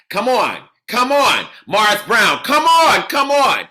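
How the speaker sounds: high, loud come on, over and over; excited cheering. Real, not acted. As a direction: pump the room up. Cheering answers from audience.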